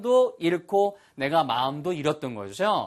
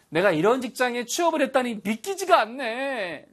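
dismayed disbelief; 9 semitones higher than usual. Shown as a demonstration. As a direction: act out forgetting in shock. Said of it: "영어로 뭐라 할까요". (높고 느린 당황한 말투)

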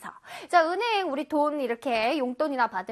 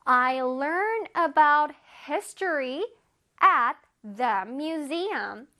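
I read books at the library, every word drawn out; slow example sentence; much slower than usual. Shown as a demonstration. Teacher reading an example sentence slowly to kids. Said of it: "예문에서 보겠습니다".

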